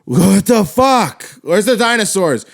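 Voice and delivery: high, loud outrage; exasperated rant, pitched right up; mad. Real, not acted. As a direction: demand an answer, loudly fed up. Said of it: "I'm so fucking mad".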